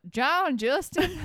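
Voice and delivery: exaggerated Australian drawl on the name; put-on Australian accent. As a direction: draw the name out in a mock Aussie accent. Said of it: "couldn't say it without the accent".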